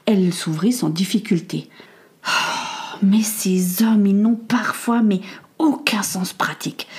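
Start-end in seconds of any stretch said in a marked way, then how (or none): 2.3-6.9 breathy sighing whisper, slow; sighed whisper. Acted. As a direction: sigh it out under your breath. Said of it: "soupira Martha".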